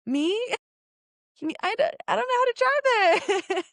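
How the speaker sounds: mock-panicked squeak; panicked protest. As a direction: yelp it out, terrified of driving stick. Told to drive manual herself.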